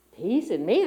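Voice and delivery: loud disbelieving rise. Retold or imitated. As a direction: challenge the idea aloud. Same words, louder challenge.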